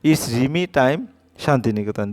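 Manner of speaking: usual speaking voice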